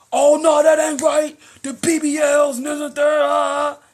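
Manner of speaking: high, loud mock protest; mock hollering. Mocking men who shout at women rappers, loud and high. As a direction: holler like an offended man.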